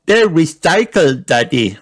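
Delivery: put-on high proud little girl voice; girl proudly telling her father. A narrator's reading. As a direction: show off proudly to dad. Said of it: "said Peppa proudly".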